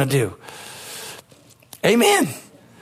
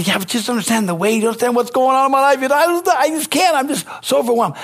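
mock overwhelmed complaint; 6 semitones higher than usual. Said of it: "people always say".

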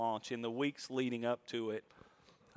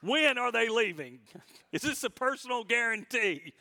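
impatient pleading; 6 semitones higher, 4 dB louder than usual. Retold or imitated. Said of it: "I can hear some thoughts out there saying".

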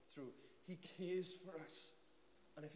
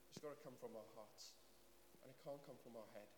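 ordinary talk, nothing marked

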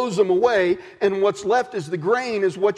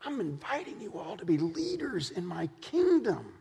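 softly inviting; 8 dB quieter than usual. Acted. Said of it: "he breaks in and he says".

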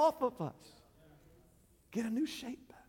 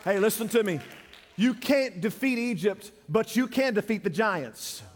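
ordinary talk, nothing marked

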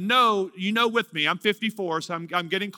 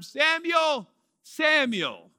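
God calling the name twice, raised; lightly voiced divine call; 7 semitones higher, much slower than usual. A narrator's reading. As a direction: call him gently twice. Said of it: "Notice that he repeats the name".

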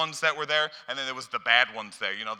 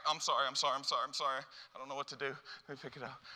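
soft, cowering apology; frightened apology; 9 dB quieter, much faster than usual. Retold or imitated. Scared sinner apologizing to a shouting God, soft. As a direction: grovel quietly in panic. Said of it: "That's how we hear this word".

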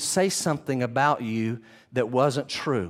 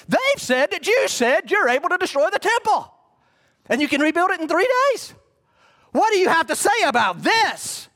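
high, loud theatrical accusation; Caiaphas accusing; 13 semitones higher, 4 dB louder than usual. Acted. High priest demanding Jesus answer, loud high outrage. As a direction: wave your arms and demand an answer.

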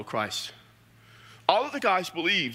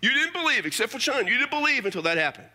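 much higher, pleading challenge; Thomas arguing; 8 semitones higher than usual. Retold or imitated. Thomas challenging the disciples, much higher and pleading. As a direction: throw it back at the others. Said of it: "He says".

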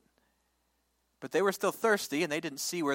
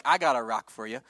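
wry God; 6 semitones lower, 5 dB louder than usual. Acted. God's ironic reply.

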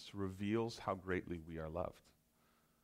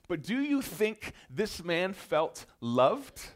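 raised, louder preacher's build, pressing each point; preaching at full force; 8 semitones higher, 7 dB louder than usual. Acted. Pastor to congregation on the leper's isolation, impassioned preaching. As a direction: drive it home from the pulpit, high and loud.

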